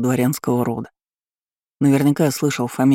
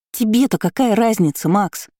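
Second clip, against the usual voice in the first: put-on girl's voice; 8 semitones higher than usual. A narrator's reading. Nastya's retort, high voice.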